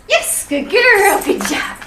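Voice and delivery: high, loud burst of praise for a dog; gushing, high-energy reward voice; really excited praise. Real, not acted. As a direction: mark it with an excited yes. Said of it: "that really excited yes sound".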